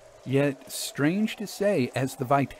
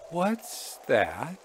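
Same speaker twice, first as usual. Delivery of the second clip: higher, anxious question; 4 semitones higher than usual. A narrator's reading. Son asks father about the parcel, anxiously. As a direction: ask with a touch of anxiety. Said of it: "with anxiety in his tone".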